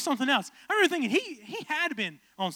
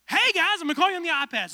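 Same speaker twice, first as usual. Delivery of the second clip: high, loud cheerful host; Ty Pennington impression; 6 semitones higher, 6 dB louder than usual. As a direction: greet them like a hyped TV host. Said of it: "Ty's like".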